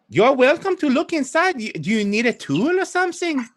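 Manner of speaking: put-on high, helpful French-accented hostess. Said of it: "French in this case would be Old Adari".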